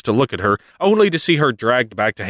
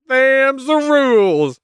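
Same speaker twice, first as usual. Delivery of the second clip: much higher, slow goofy drawl; smug Zeus; 3 dB louder, slower than usual. Retold or imitated. Zeus settling the dispute in a slow goofy high voice. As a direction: lay down the law lazily. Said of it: "Zeus is like".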